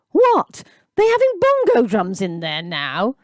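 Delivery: high, loud scoffing jeer. A narrator's reading. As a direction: scoff at the boy. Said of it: "scoffed the other man".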